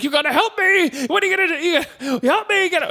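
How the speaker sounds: very high mock-panicked plea; hammed-up, high-pitched begging; imitated cry for help. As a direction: squeal it like a comic victim. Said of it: "screams for the family to help".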